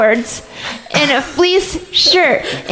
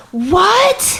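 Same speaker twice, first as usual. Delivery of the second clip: high, rising, incredulous yelp; mock disbelief; 8 semitones higher than usual. Clarinetist retells reacting to a poorly schooled player, mock-incredulous. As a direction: react as if scandalized by their ignorance.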